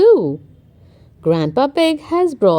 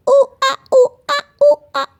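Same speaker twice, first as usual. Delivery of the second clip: very high monkey calls; cheeky monkey hooting in the trees; monkey noises; 13 semitones higher, 4 dB louder, much faster than usual. Acted. Monkey in the story.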